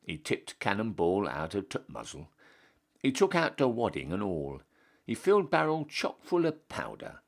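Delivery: usual speaking voice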